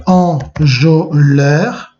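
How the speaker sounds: slow dictation, syllable by syllable; measured classroom dictation. Shown as a demonstration. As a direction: spell it out slowly for note-takers. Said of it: "On marque donc".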